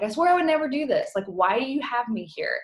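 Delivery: high, loud, fast protest; exasperated retold plea. Coach retelling her prayer to God, exasperated and loud. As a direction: throw up your hands at God.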